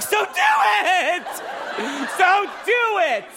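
terrified high-pitched caving; cornered dad yelling at the teen, high panicked shout. Retold or imitated. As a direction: shriek it in helpless panic. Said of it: "And I'm like".

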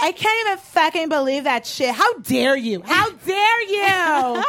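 mock indignation building to a high, stretched finish; swearing outrage. Girlfriends swear at the man who asked, acted outrage climbing in pitch. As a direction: scold him, dragging out the last repeat. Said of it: "they were just swearing".

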